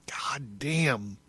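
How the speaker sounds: real, voiceless awed whisper; whispered curse of amazement; whispered aside. Real, not acted. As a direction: breathe it out under the breath.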